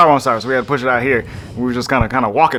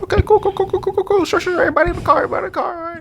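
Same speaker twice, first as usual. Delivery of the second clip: high, hammed-up rush to agree; mock nervous compliance, very high; hasty agreement; much faster than usual. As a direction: agree eagerly in a high put-on voice. Captain hastily agreeing, acted.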